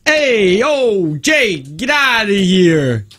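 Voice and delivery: slow, drawn-out Jersey guy attempt; put-on Jersey swagger, words stretched out; Jersey accent. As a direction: try a Travolta-like New Jersey accent. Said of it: "It sounds a little Travolta-y".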